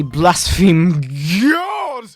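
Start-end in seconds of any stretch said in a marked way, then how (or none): 1.4-2.0 sudden high pious cry of God; mock preacher rapture on God; exalted cry. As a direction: fling the word God up like a hallelujah. Satirical preacher cries out God.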